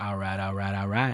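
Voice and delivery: low, soft film-star catchphrase impression; Matthew McConaughey. Host trying a film star's catchphrase, bad low impression. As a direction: badly imitate a laid-back actor, low and quiet. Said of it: "that's a terrible Matthew McConaughey impression".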